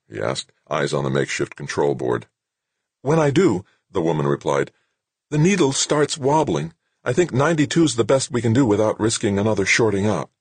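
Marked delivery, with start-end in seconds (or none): 3.0-10.3 worried woman at the controls; woman technician. A narrator's reading. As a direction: voice the tense technician reporting.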